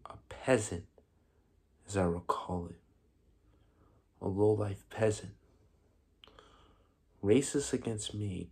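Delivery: subdued and wounded, letting each phrase sit; quiet bitterness. Acted. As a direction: speak softly, with long pauses, nursing the grievance. Bitter monologue, recalling insults.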